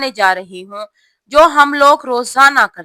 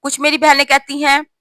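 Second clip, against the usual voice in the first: normal talking voice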